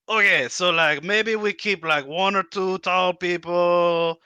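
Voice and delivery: high, slow kobold. Acted. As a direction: bargain like a little kobold. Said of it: "He says".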